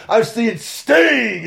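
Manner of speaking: high, loud, drawn-out name; excited. Real, not acted. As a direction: draw out Sting like a thrilled fan. Fan excitedly lists wrestlers he watched.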